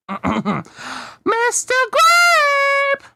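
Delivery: very high, slow, drawn-out call; a long, high, stretched-out holler through the door. Acted. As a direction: sing the name out long and high. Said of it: "Lunt walks over to the door and hollers".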